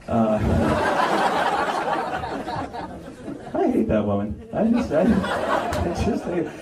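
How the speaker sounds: laughing through the words; awkward laugh while talking; nervous laugh. Acted. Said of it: "that's a nervous laugh".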